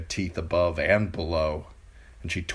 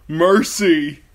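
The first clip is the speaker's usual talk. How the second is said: high, loud terrified sobbing cry; sobbing plea; 11 semitones higher, 11 dB louder than usual. A narrator's reading. Theon waking from a nightmare, sobbing for mercy. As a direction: beg for mercy through sobs. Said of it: "he sobbed".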